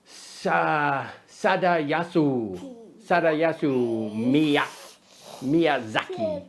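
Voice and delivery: put-on over-the-top Japanese accent, stumbling over the name. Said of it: "over-the-top rendition of the Japanese accent".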